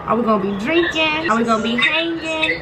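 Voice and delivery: loud, high, excited calling. Real, not acted. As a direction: call it out across the room. Calling loudly to friend on phone.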